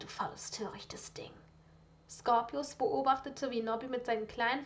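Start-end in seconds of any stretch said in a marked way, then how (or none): 0.0-1.3 hissed insult. Acted. Lucius scolds the house-elf.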